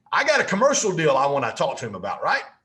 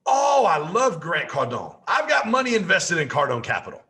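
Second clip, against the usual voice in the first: excited friend; 5 semitones higher, 5 dB louder than usual. Retold or imitated. Friend Steve answering on phone.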